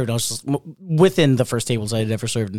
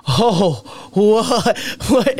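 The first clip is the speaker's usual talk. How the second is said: shocked; 8 semitones higher, 4 dB louder, much slower than usual. Retold or imitated. Retelling shock at divorce papers.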